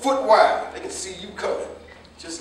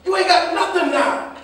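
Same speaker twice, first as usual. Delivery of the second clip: angry accusation; 6 semitones higher, 4 dB louder than usual. Acted. Father condemning son's wasted life.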